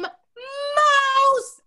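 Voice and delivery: very high, loud shriek; scream. A narrator's reading. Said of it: "Ronald would scream".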